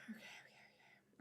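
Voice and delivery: breathy whispered self-soothing; real calming whisper. Real, not acted. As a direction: whisper it to steady yourself. Calming herself after excitement.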